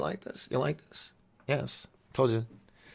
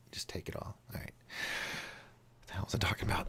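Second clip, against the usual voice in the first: usual speaking voice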